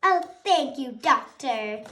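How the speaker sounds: ordinary delivery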